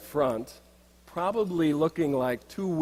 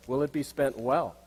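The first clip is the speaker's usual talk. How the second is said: normal talking voice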